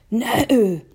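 put-on high, drawn-out no from an old insect; old grasshopper. A narrator's reading. Old grasshopper answers James, high and unhurried. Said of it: "he answered".

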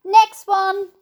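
high, loud call; shouting. A narrator's reading. James jumping back into the tunnel, shouting. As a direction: call briskly for the next. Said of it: "he shouted".

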